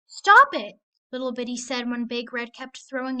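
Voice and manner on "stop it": high, loud cry of stop it. Acted. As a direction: yell it at the bully to make her quit. Bitty tells bully to stop.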